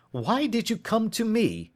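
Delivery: high, exasperated cry; detective cries out in frustration; Holmes crying out. Acted. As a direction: throw the question at him. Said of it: "he cried".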